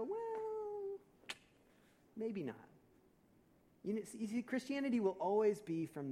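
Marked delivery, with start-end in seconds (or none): 0.0-2.7 hesitant backing off. Retold or imitated. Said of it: "we go".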